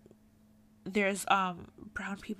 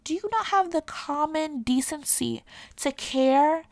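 raised, louder indignant challenge; 5 semitones higher, 5 dB louder than usual. Real, not acted. Rebuking those who don't care people are offended, indignant. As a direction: scold them, higher and louder.

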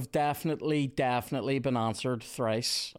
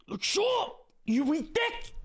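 furious shout; slower than usual. Real, not acted. Gamer yelling at Luke Shaw for conceding, furious shout. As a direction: scream abuse at the useless defender.

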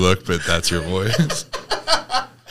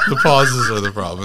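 laughing; 4 semitones higher, 8 dB louder than usual. Real, not acted.

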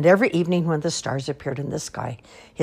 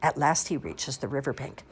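plain reading voice

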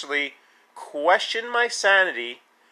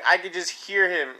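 ordinary talk

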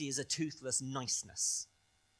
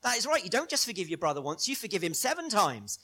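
high, loud mock congratulation; big, emphatic well-done; imagined hearty approval; 9 semitones higher, 5 dB louder than usual. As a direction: praise him with exaggerated delight. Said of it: "He wants Jesus to say".

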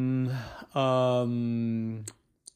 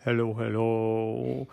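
echo; 5 semitones lower, 4 dB quieter than usual. Acted. Empty house answering Nate, low echo. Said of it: "echoed back".

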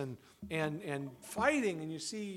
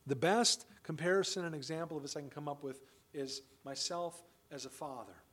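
ordinary delivery

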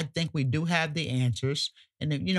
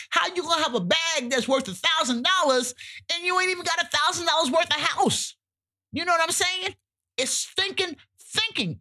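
much higher, disbelieving, ranting about the bags; incredulous rant; 12 semitones higher than usual. Real, not acted. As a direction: scoff at the absurdity in a high pitch. Ranting about pricey Gucci bags.

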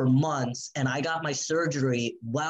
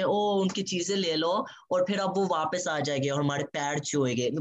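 mocking the family; 3 semitones higher, much faster than usual.

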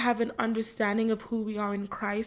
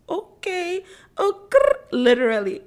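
excited okay; 7 semitones higher, 8 dB louder than usual. Real, not acted. Excited reaction to own quote.

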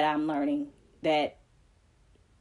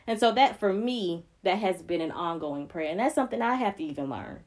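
ordinary talk, nothing marked

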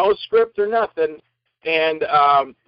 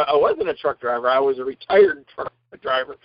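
ordinary delivery

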